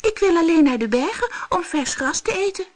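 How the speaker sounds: put-on a timid little kid goat pleading; small goat, high and meek. Little goat speaks to monster.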